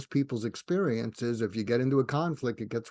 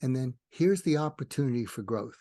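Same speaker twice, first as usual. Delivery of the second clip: ordinary talk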